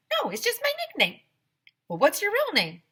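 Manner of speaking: high, bright questioning; lively character voices; playful story voices. Acted. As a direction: banter back and forth. Dialogue between narrator and handyman.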